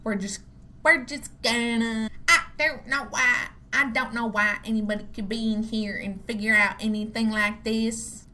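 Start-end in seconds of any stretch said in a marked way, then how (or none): 0.8-2.1 Southern-inspired twang on a false start; made-up Southern accent. Shown as a demonstration. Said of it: "today's accent". 2.3-8.3 Southern-inspired accent. Shown as a demonstration. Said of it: "today's accent".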